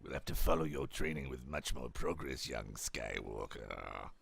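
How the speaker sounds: very low, slow, drawn-out villain voice; Palpatine. Acted. Evil master to his young pupil, villain impression. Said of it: "That's not really good at Palpatine".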